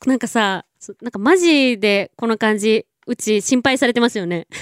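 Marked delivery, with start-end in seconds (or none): none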